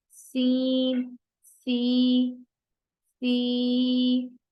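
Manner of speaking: ordinary talk, nothing marked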